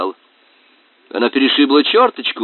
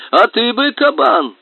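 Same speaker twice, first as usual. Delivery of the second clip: high, loud call toward the well; shouting; 13 semitones higher, 5 dB louder than usual. Acted. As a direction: shout at the boar. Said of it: "закричал он в сторону колодца".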